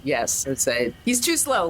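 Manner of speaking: ordinary delivery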